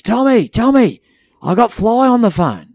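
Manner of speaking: put-on eager drunk bloke waving his phone about; excited call. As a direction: drunk mate calling out from across the pub. Drunk Paddy calls out.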